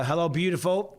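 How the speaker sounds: ordinary talk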